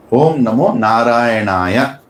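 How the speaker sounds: "Narayanaya" slow and stretched through to "ya"; complete mantra pronunciation. Shown as a demonstration. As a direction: draw out "Om Namo Narayanaya" to its last syllable. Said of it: "Then only the mantra is complete".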